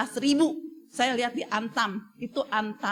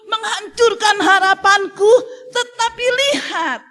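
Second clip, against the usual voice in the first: rising, louder, higher preaching over the congregation; fiery preaching; 6 semitones higher, 7 dB louder than usual. Acted. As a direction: preach it harder and harder, pushing each phrase up. Preacher builds to full force.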